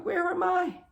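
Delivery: high-pitched, acted-out alarm; lost and alarmed, voice jumping up; reliving panicked disorientation; panicked bewilderment. Acted bewilderment in retold vision.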